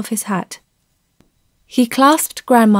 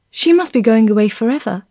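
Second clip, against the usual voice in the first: ordinary delivery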